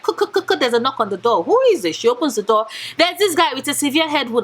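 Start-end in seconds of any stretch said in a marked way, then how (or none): 0.0-0.6 high, quick knocking noises made with the voice; knock imitation. Narrating the story, booktuber voices the door knocking, high. 1.4-1.9 higher and a bit louder, calling to the door. Retold or imitated. Heroine calls to a stranger at the door, raised voice.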